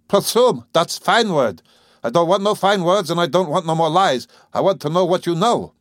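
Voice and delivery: loud, high whining outburst; clamor it, voice climbing into a whine. A narrator's reading. Angry father clamoring at the doctor's wife, loud whine. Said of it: "He clamored".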